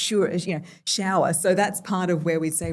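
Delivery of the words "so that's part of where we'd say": ordinary delivery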